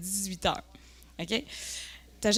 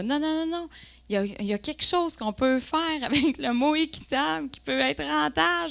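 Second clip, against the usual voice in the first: higher, insistent objection; mock eager protest; 6 semitones higher than usual. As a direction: argue the case in a raised voice. Se revoit protester au HEC. (protestation enjouée et insistante)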